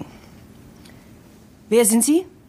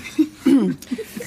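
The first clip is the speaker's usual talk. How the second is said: short laugh; 5 semitones higher than usual. Acted. Mocking laugh at Vince's joke.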